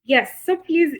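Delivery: normal talking voice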